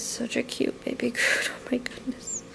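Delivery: gushing whisper over something cute; breathless whisper, melting at cuteness; overwhelmed by cuteness. Real, not acted.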